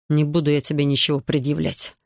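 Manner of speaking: usual speaking voice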